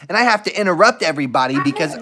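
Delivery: ordinary talk, nothing marked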